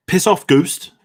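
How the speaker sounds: a high, loud attempt at a New Zealand accent; badly faked New Zealand accent as Korg. Quizzer trying a New Zealand accent. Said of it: "I really can't do a New Zealand accent".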